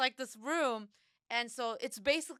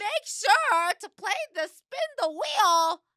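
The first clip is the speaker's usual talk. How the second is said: high, loud, drawn-out announcer yell; yelled announcement; 11 semitones higher, 10 dB louder, slower than usual. Retold or imitated. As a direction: bellow it over a noisy room, pitched way up. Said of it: "I'm yelling".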